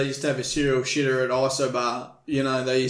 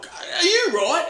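high, drawn-out startled query; mock affronted question; 4 dB louder, slower than usual. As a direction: act out the interrupted man, voice shooting up. Acted singer caught in the cubicle.

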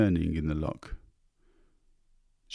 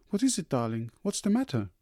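worried wife; 6 semitones higher than usual. A narrator's reading.

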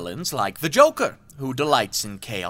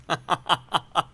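chuckling; 5 semitones higher than usual. Acted. Villain gloats.

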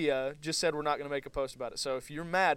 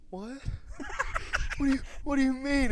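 shocked dad; 11 semitones higher than usual. Retold or imitated. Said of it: "my dad goes".

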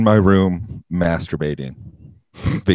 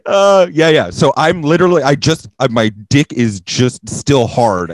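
higher and louder, laughing through it; half laugh the awkward confession; laughing along; 7 semitones higher, 8 dB louder than usual. Real, not acted. Admitting the embarrassing detail to the host, laughing.

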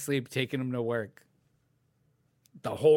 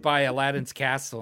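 normal talking voice

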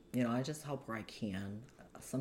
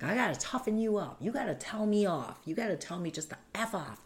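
bully's tough talk; 8 semitones higher, 4 dB louder than usual. Retold or imitated.

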